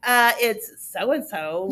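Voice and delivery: slow, emphatic you're-so-dumb answer; talking down. Retold or imitated. Upset kid answering the counselor as if she's dumb, condescending. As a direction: drag it out as if the listener is dumb. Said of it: "acts like you're dumb".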